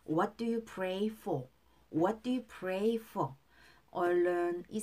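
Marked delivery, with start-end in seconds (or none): 0.1-3.3 slow, even teacher's model line; slow model reading. Shown as a demonstration. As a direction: spell each word out slowly. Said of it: "여러분은 요즘 무엇을 위해서 기도하십니까". (천천히 또박또박 시범 발음)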